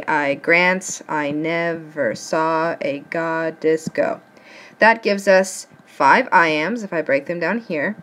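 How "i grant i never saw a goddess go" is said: slow, measured beat: i GRANT, i NEV-er SAW a GOD-dess GO; marking the iambic stresses. Shown as a demonstration. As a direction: scan it syllable by syllable, leaning on "grant", "saw" and "go". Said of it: "go through and say".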